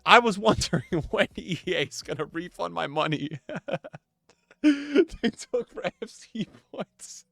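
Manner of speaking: words breaking up into real laughter; laughing while reading. Real, not acted. Streamer reads a refund request to viewers, cracking up. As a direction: try to read on through a laughing fit.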